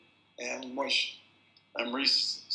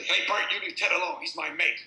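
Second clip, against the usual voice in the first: loud, higher call across the pub; warning call; 5 semitones higher, 6 dB louder than usual. Retold or imitated. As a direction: call out to stop the fight. Local warns off a brawler.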